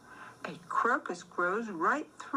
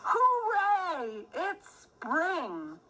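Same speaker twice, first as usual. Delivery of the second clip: cheering hooray; 8 semitones higher, much slower than usual. Acted. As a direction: shout it out for spring. Spring arrives cheer.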